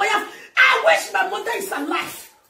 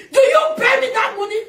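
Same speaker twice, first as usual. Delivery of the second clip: louder, higher, with a crying edge; tearful demand; 6 semitones higher, 3 dB louder than usual. Acted. Demanding the container money from family, near tears. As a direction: plead it as if close to tears.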